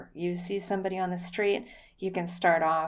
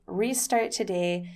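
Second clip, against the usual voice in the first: ordinary talk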